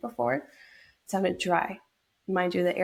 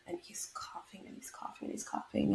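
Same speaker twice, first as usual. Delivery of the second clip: whispered, barely voiced, much softer; hushed whisper; 2 semitones higher, 12 dB quieter than usual. Real, not acted. Wife recalling her husband's endless cough, in a hushed whisper. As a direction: drop to a near-silent whisper as if not to wake anyone.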